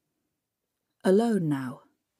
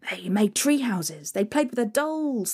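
ordinary delivery, nothing marked

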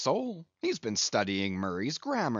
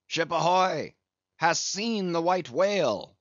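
loud call across the water; hailing cry; 4 semitones higher, 4 dB louder than usual. Acted. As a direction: hail the ship through a trumpet. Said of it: "So cried Ahab".

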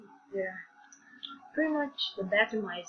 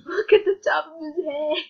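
laughing through the words, higher and louder; 5 semitones higher, 5 dB louder than usual. Real, not acted. Vlogger cracking up at a character picture, laughing. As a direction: crack up while pointing at his head.

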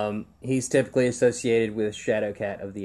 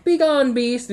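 much higher, louder mock royal dismissal; haughty Inhumans; 11 semitones higher, 3 dB louder, slower than usual. Son retelling how the Inhumans shooed Lockjaw, high mock command. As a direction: shoo the beast away like snooty royalty. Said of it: "they're like".

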